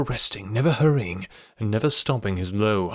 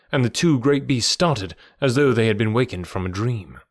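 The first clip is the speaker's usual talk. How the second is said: usual speaking voice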